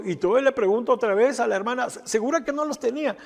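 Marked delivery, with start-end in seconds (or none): 1.9-3.2 high-pitched doubtful double-check; double-check, still not believing it; retold disbelief. Pastor asks the mother once more, retold disbelief. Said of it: "estaba que no lo creía".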